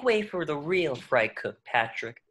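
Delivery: low, self-important brag; cocky boast. Acted. Fry cook boasts to his rival, low and cocky. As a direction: drop low to swagger past him.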